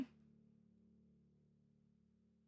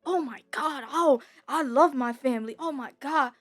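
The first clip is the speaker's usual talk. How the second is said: higher, louder gushing "oh my God"s that build to squealing and laughing; mock gushing schoolmates; 3 semitones higher, 4 dB louder than usual. Mimicking classmates at school gushing over presents, hammed-up squeals. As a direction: ham up the kids bragging about their gifts. Mocking classmates bragging about gifts.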